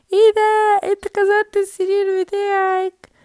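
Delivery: gleeful discovery; shocked exclamation. Acted. Brother finds broken bed. (صدمة عالية)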